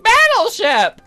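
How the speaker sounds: put-on very high, loud girly squeal; excitable game girl. Voices Aseline in game dialogue.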